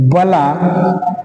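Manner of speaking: slow, halting pace for note-takers; slow dictation. Real, not acted. Teacher dictating a heading to students, slow dictation. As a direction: dictate word by word with long pauses.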